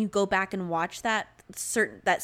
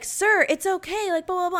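soothing rescuer; 10 semitones higher than usual. Retold or imitated. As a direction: talk him down like a paramedic. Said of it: "the guy is like".

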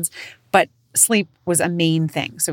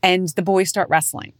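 ordinary delivery

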